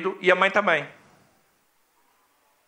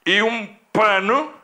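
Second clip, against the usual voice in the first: usual speaking voice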